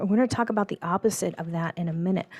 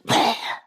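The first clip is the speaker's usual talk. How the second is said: quick audible sniff of breath; sharp sniff in; 5 semitones higher, 4 dB louder than usual. Shown as a demonstration. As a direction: draw the air in through the nose. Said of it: "we hold it in".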